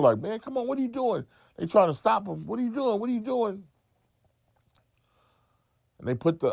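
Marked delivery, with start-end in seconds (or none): 0.2-1.2 much higher, alarmed plea; pleading bystander. Retold or imitated. Bystanders stopping man with rifle. 2.5-3.6 much higher, alarmed plea; pleading bystander. Retold or imitated. Bystanders stopping man with rifle.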